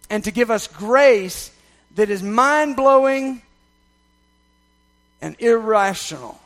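loud, swelling preacher's build; preaching at full force. Acted. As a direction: lean into every word and lift it.

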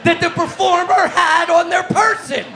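loud, high, laughing mock outrage; frat bro. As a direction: bellow it like an offended party bro. Mock outrage at forehead remark.

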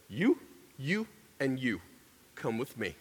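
quiet, low authority, lightly acted; stern assistant principal. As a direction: pick them out softly, brooking no argument. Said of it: "you don't mess with her".

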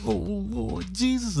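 slow, high, scared murmur; scared hiker. Retold or imitated. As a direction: gasp it out in fear. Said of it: "the other one was scared".

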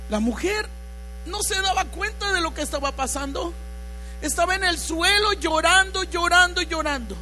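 loud, fervent sermon voice over music; preaching at full force. Acted. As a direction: preach it out with passion. Preaching woman's repentance. (predicando con fuerza y fervor)

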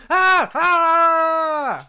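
loud high screaming, like under fire; war-trench scream. Retold or imitated. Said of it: "that's what they sound like".

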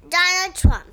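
loud, proud announcement; called out. Real, not acted. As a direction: shout the name out. Child calls out the name.

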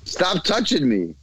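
high, slow whiny protest; mock squeamish listener. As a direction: whine like someone being tickled. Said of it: "then they're like".

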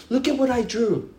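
a hint of a little girl showing off; proud child. Daughter showing her father a drawing, lightly childlike.

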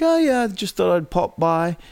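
casual drop-in line in a mock English accent; attempted English accent. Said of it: "he's Australian, right? English".

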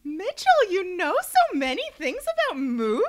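high, sing-song fawning over him; gushing doctor. Retold or imitated. Doctor gushing at the boyfriend, high fawning coo. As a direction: coo his name up high, delighted.

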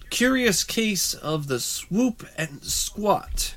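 slow, high "curious case" announcer read; dramatic announcer. Acted. Host introduces next case, slow dramatic announcer. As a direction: draw out "curious case of the swoop and squat" like a TV mystery host. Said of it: "introducing the case title".

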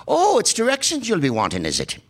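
hammed-up Irish brogue, high start; a twinkly local dodging the question in brogue; Irish accent.

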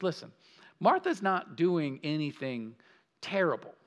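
normal talking voice

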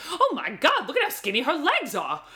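high, amused, mock-astonished gush; gushing imitation. Podcaster mimicking an editor admiring the model, mock-gushing. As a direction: imitate him gasping in delight, laughter creeping in. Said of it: "and he goes".

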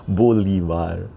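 slow Spanish-accented Bolivar, rolled R; drawn-out Latin accent on Bolivar; Chicho accent. Shown as a demonstration. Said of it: "so I do the Chicho accent".

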